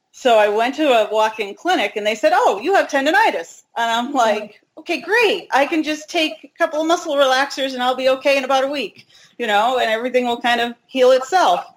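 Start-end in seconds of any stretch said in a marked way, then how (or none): 2.3-3.6 higher-pitched quoted clinic voice; imitating clinic staff, pitched up; retold diagnosis. 4.8-11.6 higher-pitched, naively hopeful retelling; lightly acted easy relief; mock optimism.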